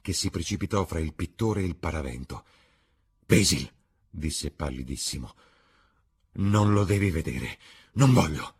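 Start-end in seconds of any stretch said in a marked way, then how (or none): 3.2-3.7 sudden loud, alarmed call; panicked cry. A narrator's reading. Dorian blocks the painter from the screen, panicked cry. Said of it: "Un grido di panico". 6.4-8.6 urgent, alarmed prohibition; panicked refusal. A narrator's reading. Dorian keeps Basil from the portrait, alarmed. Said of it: "Un grido di panico".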